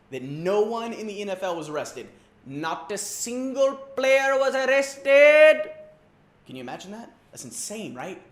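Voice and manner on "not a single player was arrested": loud, slow, incredulous; amazed. Acted. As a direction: stretch it out in disbelief. Disbelieving headline.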